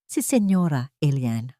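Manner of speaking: ordinary delivery, nothing marked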